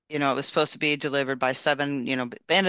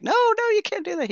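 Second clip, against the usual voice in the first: much higher, panicked no no; alarmed protest; 3 dB louder than usual. Retold or imitated. Woman begging the driver not to return her package, alarmed high protest. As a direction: plead as if the package is lost. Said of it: "And I'm like".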